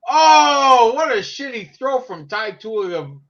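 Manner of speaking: loud, high drawn-out groan of disbelief; frustrated fan yelling at the game; exasperated outcry. Real, not acted. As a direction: cry out at a blown play, then gripe. Reacting to a bad throw live.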